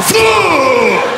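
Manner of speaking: high, long open-mouthed sneeze blast; explosive mock sneeze. Stranger sneezing with mouth uncovered, big mock sneeze. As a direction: cock back and let the sneeze fly. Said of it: "he just cocked and".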